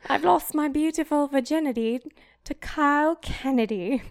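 high, loud, hammed-up British diarist; British accent. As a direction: proclaim it in a put-on British accent. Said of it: "should I do it in a British accent?".

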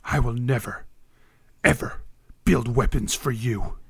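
quietly refuse, every word a vow; defiant refusal. Acted. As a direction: smith refusing his dragon captor, low and defiant. Iron Shaper refuses the dragon.

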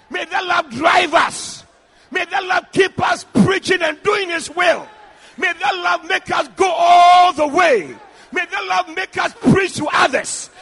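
loud, chanting exhortation with drawn-out key words; fervent pulpit proclamation; full-force preaching. Real, not acted. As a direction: preach it as a rising prayer over the congregation.

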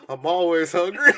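playful shout of hunger; shouted punchline. Retold or imitated. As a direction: bellow the punchline with glee. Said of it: "That's my secret, Cap".

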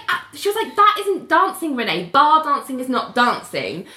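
high, indignant protest; indignant Ali. Retold or imitated. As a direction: squeal it out, offended. Acting out Ali's outraged reply.

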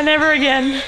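ordinary talk, nothing marked

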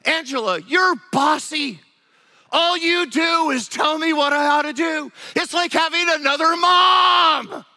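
high, loud, slowing shout at his wife; husband shouting that his wife is bossy; angry accusation. Retold or imitated. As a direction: blow up at her like a fed-up husband. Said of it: "and now he goes".

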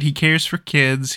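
ordinary talk, nothing marked

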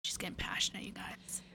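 whispered aside to listeners; breathy stage whisper. Real, not acted. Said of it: "aside to listeners".

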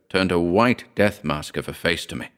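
ordinary delivery, nothing marked

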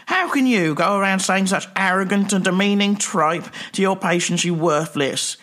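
indignant rant. Acted. Sketch, furious rant.

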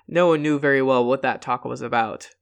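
ordinary delivery, nothing marked

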